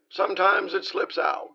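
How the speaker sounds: ordinary talk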